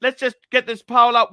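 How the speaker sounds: ordinary delivery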